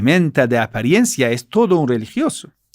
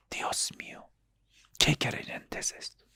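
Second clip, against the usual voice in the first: amazed whisper; 3 semitones lower, 16 dB quieter than usual. Acted. Astonished believer watching demon pray.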